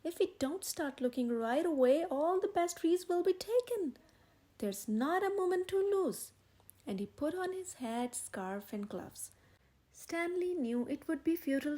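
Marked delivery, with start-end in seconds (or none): 0.0-6.3 higher and louder, rushing to get going; no time to lose, press him; eager, hurried insistence; urgent. A narrator's reading. Henry urging haste.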